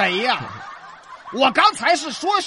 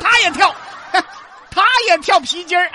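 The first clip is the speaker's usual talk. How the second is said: laughing; 8 semitones higher, 6 dB louder than usual. Real, not acted. Teasing partner through laughter.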